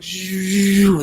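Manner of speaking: high, sustained whooshing noise; long high-pitched zhoo of incoming wind; imitated wind gust. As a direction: whoosh like a sudden rush of wind. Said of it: "the winds were coming in".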